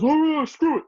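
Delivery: high, loud fed-up squawk; imitating Don Vito. Don Vito giving up on the eggs, high squawk. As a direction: give up loudly in a cracked high voice. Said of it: "he goes".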